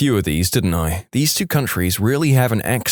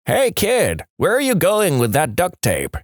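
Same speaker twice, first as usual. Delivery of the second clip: put-on old man yelling; 7 semitones higher than usual. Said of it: "Old guy yells out".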